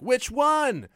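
high, loud snide question; high heckler voice. Retold or imitated. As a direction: fire it back as the heckler. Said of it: "She's like".

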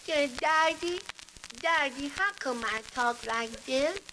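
high, slow, put-on child voice; little girl voice. Little girl asking her daddy, child voice. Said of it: "about the little girl who walked up to her mother".

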